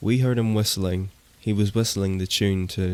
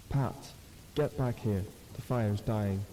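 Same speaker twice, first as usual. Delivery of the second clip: much softer, warped, like a stretched cassette tape; distorted copy; 8 dB quieter than usual. Acted. Something in the trees faking Tom's voice, warped and metallic. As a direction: make it sound like a broken tape with a metallic grind. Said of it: "it was distorted, like ... warped cassette tape".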